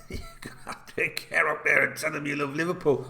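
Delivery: giggling as you say it again; laughing at your own joke. Real, not acted. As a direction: repeat the line through real laughter.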